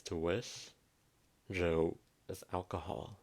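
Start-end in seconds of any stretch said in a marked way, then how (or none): none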